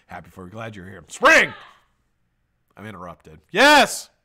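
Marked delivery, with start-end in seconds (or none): 1.1-1.5 high, loud exclamation; sudden shout. Real, not acted. Calling out, interrupting own talk. 3.5-4.1 high, shouted burst; loud exclamation. Real, not acted. Exclaiming after an interruption.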